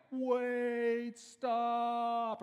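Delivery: high, loud drawn-out cry of alarm; slow-motion shout. Retold or imitated. Onlookers in a movie scene trying to stop her, loud high shout. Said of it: "people are like".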